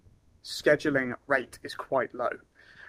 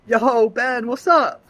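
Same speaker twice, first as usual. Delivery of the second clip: high, loud, excited yo; casual buddy greeting; 8 semitones higher, 8 dB louder, much slower than usual. Shown as a demonstration. Caller greeting a friend, loud and excited. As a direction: hype up like calling a mate. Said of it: "So it'd be like".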